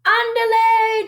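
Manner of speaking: very high, slow rallying call; rally the friends to action; high, loud, drawn-out rallying cry; declaring. A narrator's reading. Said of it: "declared Don Diego".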